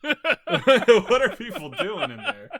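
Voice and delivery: slow, laughing the words out; real laughter breaking through. Real, not acted. Asking friends about the bathroom, laughing hard.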